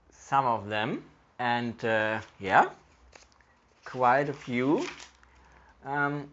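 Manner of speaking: talking around pipe stem; pipe in mouth. Real, not acted. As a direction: teeth clenched on pipe. Seen 0: Pipe clenched between teeth throughout, held in hand otherwise.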